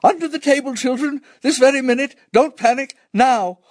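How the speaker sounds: high-pitched, loud motherly command; shouting. A narrator's reading. Mother sending children under the table, shouting. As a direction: shout orders to hide your fear. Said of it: "actually shouted".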